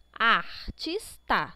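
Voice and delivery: Artista slowly split as ar-tis-ta; syllable by syllable. Shown as a demonstration. Teacher counts syllables for the exercise, syllable by syllable. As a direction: leave a gap after each piece of Artista. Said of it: "separe a sílaba das palavras".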